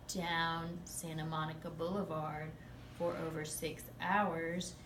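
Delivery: slow, drawn-out account of the chase; slow, even retelling; slow telling. Shown as a demonstration. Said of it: "Higher pitch tone and faster rate".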